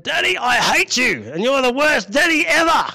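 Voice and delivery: put-on high whiny little girl voice; sulky child. Daughter denied a treat, high sulky child voice. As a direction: pout like a cross little daughter. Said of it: "she said".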